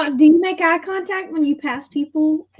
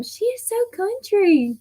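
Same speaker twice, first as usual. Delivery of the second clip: higher-pitched quote of what people say; raised voice, teasing imitation; lightly imitated remark; 8 semitones higher than usual. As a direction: lightly mimic folks commenting, pitched up. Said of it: "people tell me all the time".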